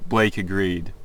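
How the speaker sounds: ordinary talk, nothing marked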